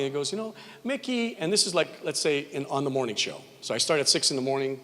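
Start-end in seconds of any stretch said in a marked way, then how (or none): 0.3-1.4 lightly voiced soft-spoken friend, pitched up; imitating a gentle caller; soft-spoken Sheldon. Friend imitating Sheldon calling him softly. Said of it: "he speaks so softly".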